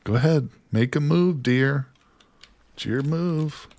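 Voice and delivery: play-acted dare to an opponent; playful taunt. Daring the deer to attack.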